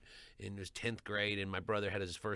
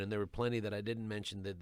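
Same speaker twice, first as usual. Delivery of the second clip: usual speaking voice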